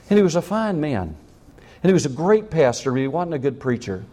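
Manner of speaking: usual speaking voice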